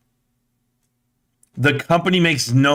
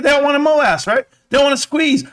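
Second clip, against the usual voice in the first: high emphatic rant; 11 semitones higher than usual. Real, not acted. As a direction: pitch way up, insistent. Emphatic scolding.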